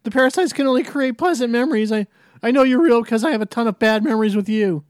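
lightly voiced anxious teen; nervous, pleading kid; Morty voice.

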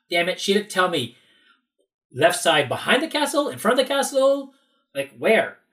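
loud, high frustrated complaint; exasperated. Retold or imitated. Visitor left with vague castle directions, exasperated. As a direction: demand the missing details in exasperation.

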